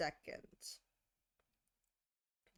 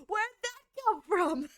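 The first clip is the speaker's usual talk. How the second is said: high, slow mock question; 10 semitones higher, 3 dB louder, slower than usual. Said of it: "I told her".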